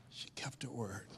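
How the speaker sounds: whisper. Shown as a demonstration. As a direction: breathe it out as a secret. Repeated line, second take whispered.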